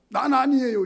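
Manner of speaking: loud, high indignant denial; a mocked sinner denies guilt, loud and high. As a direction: protest innocence like the people. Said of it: "이 백성들처럼".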